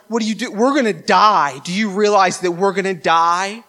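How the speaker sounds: mildly panicked disciples, voice lifted; afraid of dying. Retold or imitated. Disciples waking Jesus in the storm, acted mild panic. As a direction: act out alarmed disciples, stressing the danger. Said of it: "they're afraid that they're going to die".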